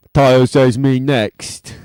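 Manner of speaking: silly chat voice. Retold or imitated. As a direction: read it out in a goofy voice. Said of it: "I basically just hear him as".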